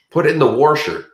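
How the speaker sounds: put-on Michigan accent. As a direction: imitate a Michigan accent with an r in wash. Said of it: "the Michigan, where instead of wash".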